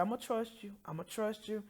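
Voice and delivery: hushed, soft prayer; quiet. Retold or imitated. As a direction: say it softly, surrendering. Quiet surrender prayer to God.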